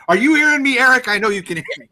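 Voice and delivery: loud, high-pitched teasing call to an absent listener; playful call-out. Acted. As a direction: tease him by calling out loudly.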